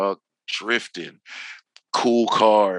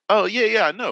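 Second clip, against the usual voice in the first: higher, acted-out quick agreement; eager recognition; 9 semitones higher, faster than usual. Newcomer assuring the instructor he understands, eager. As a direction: imitate catching on at once.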